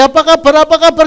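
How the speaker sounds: very high, loud, rushed greeting; excited mock greeting. Preacher mimicking the suitor's greeting, high and loud. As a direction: ham up the suitor's hello. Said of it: "Loh, kok dari".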